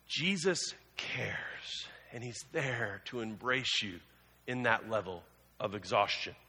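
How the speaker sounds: soft, slow and gentle; tender reassurance. Real, not acted. Pastor reassures exhausted listeners, softly and tenderly. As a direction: hold them gently with the words.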